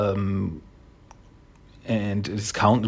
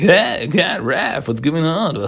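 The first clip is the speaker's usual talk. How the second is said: higher, tipsy-sounding greeting with a laugh; Casey; 3 semitones higher than usual. Retold or imitated. Drunk friend greets Raph, higher mock-jolly voice. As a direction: act out an over-jolly hail, pitched up. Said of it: "by the way Casey is acting".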